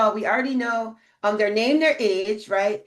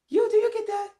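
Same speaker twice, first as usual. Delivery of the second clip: high mock disbelief; 12 semitones higher than usual. Real, not acted. Rhetorical punch to her audience.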